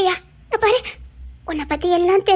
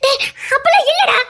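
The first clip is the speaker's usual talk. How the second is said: crying shout; 6 semitones higher than usual. Acted. Mother shouting through tears to stop her son. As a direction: cut him off with a sobbing shout.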